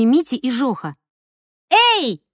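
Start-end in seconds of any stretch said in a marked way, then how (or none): 1.7-2.2 high, loud call to stop them; shout. Acted. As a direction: call out sharply for attention. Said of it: "крикнула она".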